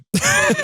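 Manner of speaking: very high, short rueful laugh. Real, not acted. Guest admits he ran out of money, high rueful laugh. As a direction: laugh at your own mistake.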